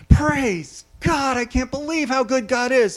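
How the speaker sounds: high, excited shouted praise; yelling praise. Retold or imitated. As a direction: burst out again praising God. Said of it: "she jumps up again".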